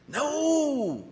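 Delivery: high, long drawn-out no; emphatic refusal. Real, not acted. Preacher rejecting endless forgiving of affairs, emphatic. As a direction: swoop up high and fall, rejecting it outright.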